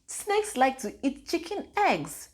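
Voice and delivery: put-on a mother echoing her child's words in amazement; astonished repeat. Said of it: "I said".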